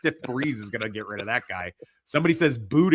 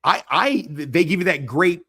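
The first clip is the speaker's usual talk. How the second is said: excited; 7 semitones higher, 7 dB louder than usual. Real, not acted. Launching an argument loudly.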